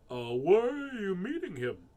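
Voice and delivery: high, loud, swooping owl; storybook owl voice; owl character. Acted. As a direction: hoot the question like an owl. Owl asks mouse.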